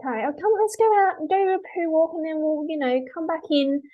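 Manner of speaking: high, quick, chirpy owner voice; cheery mum. Retold or imitated. A dog owner chirpily planning the walk, high and quick. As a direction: play the breezy mum heading out. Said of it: "mum goes like".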